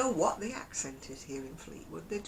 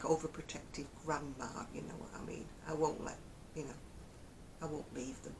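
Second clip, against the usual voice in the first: usual speaking voice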